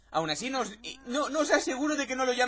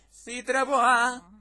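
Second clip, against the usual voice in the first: slow, louder, stretched name; drawn-out name call; 3 semitones higher, 6 dB louder, much slower than usual. Acted. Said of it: "lo dices así súper rápido". (nombre alargado, llamando)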